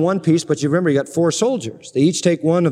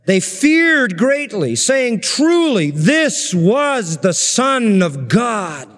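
slow, reverent reading of the verse; solemn scripture reading; 5 semitones higher, much slower than usual. Acted. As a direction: draw out each word with awe.

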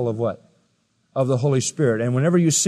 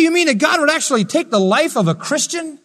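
high-pitched mock-astonished question; incredulous preaching; 13 semitones higher, 5 dB louder than usual. Preacher imitating a shocked churchgoer's question, incredulous preaching. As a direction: voice a disbelieving listener, pitched way up.

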